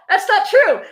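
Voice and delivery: a squeaky outraged denial; squeaky protest. Real, not acted. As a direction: rise up in disbelief. Protesting the book's claim.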